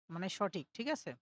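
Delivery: ordinary talk, nothing marked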